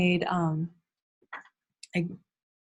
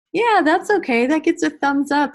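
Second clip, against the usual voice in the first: high, louder teacher enthusiasm; cheerful approval; 8 semitones higher, 6 dB louder than usual. Pretend. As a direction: affirm it brightly for young children. Acting out class discussion with children.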